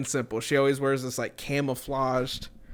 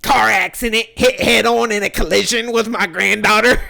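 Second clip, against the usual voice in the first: higher, louder put-on old woman voice; mimicking the coworker; 7 semitones higher, 9 dB louder than usual. Coworker announcing her car accident, in a higher, louder mocking voice. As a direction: play her blurting out the crash news. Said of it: "She's like".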